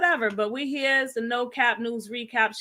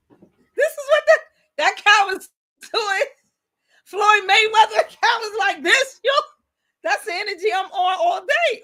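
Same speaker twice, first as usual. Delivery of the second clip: laughing; 9 semitones higher, 5 dB louder than usual. Real, not acted. Host cracking up over clip.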